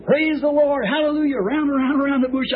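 high joyful shouted praise; retold shouting praise while circling a bush. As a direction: raise the pitch and shout for joy. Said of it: "I was screaming".

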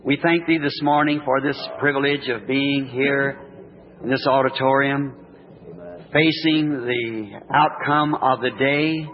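soft, reverent prayer voice; gentle warm blessing. Real, not acted. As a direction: pray quietly and humbly. Leads opening prayer.

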